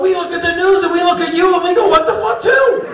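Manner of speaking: very high, exasperated yelling; shouted retort. Retold or imitated. Iranian guests throw the bafflement back at visitor, shouting. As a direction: build to a loud, high-pitched comeback.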